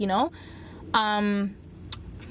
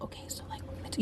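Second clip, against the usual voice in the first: barely audible, voiceless whisper; 12 dB quieter, much faster than usual. Retold or imitated. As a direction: whisper so faintly you can hardly hear yourself. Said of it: "So I'm whispering".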